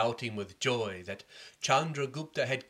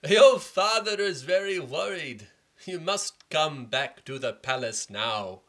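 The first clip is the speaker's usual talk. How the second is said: slow, louder urgent summons; lightly voiced messenger; 4 semitones higher, 8 dB louder, slower than usual. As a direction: deliver the king's worry slowly. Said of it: "he told the princes".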